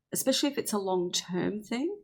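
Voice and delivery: usual speaking voice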